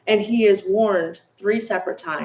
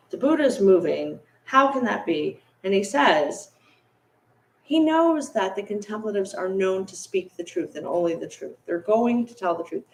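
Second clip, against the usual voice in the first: normal talking voice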